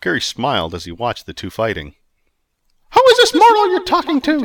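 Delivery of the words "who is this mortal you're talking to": very high, loud goddess demand. Acted. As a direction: question him sharply. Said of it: "she demanded".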